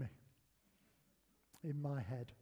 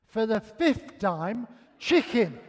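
slow, loud, high-pitched retort; mock exasperation, punching each word; exasperated wife; 4 semitones higher, 8 dB louder, slower than usual. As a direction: spell it out, fed up with repeating. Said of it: "She turned round and she said".

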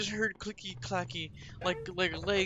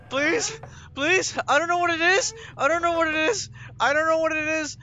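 high, loud desperate begging; real scared cries; frightened pleading; 9 semitones higher, 8 dB louder than usual. Real, not acted. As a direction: plead with panic in the voice. Begging while chased by creature.

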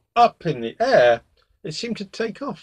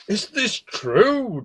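slow, raised-pitch kingly questioning; stern demand; 10 semitones higher, much slower than usual. A narrator's reading. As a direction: demand an answer, drawing it out with mild anger. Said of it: "demanded the king".

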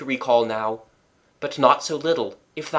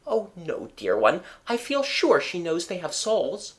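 reassuring wife; 5 semitones higher than usual. A narrator's reading. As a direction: reassure the husband kindly.

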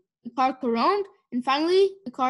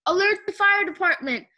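raised, higher urgent call; mild shout; 6 semitones higher, 4 dB louder than usual. A narrator's reading. Mister Watson calling for the fire department, mildly shouted. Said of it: "shouted Mister Watson".

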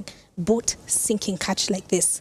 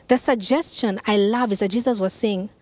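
normal talking voice

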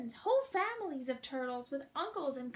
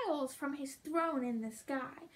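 ordinary delivery, nothing marked

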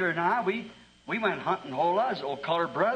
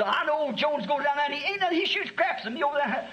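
put-on higher old man's voice, grumbling; old Gabe complaining; 8 semitones higher than usual. Gabe griping about Jones to the pastor, higher voice. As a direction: complain like an aggrieved old hunter.

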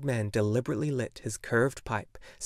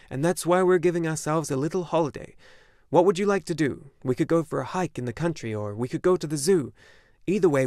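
father's voice, higher and louder, bubbling over; excited father; 5 semitones higher, 5 dB louder than usual. A narrator's reading. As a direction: sell the day as a grand treat. Father offering treats.

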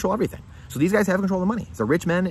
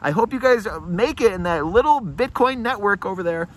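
mocking yell; 9 semitones higher, 4 dB louder than usual. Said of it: "they're yelling back at you".

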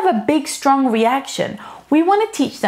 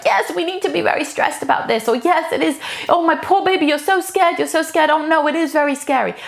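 higher and overwrought, fretting along; overanxious parent; 5 semitones higher than usual. Retold or imitated. Parent catching the child's fear, higher and fretful. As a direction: mirror the child's panic with worried coos. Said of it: "take on the emotions and act them out myself".

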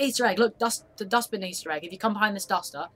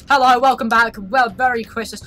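loud, put-on host welcome; hammed-up voice-over intro; video intro greeting; 2 semitones higher, 8 dB louder than usual. Shown as a demonstration. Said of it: "voice it over till there".

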